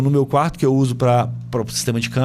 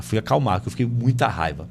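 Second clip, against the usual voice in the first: ordinary delivery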